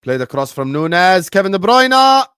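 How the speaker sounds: live match commentary, rising to an excited peak; football commentator. Acted. Commentator calling a cross to Kevin De Bruyne, building excitement. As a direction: build to a loud, high-pitched shout on the player's name.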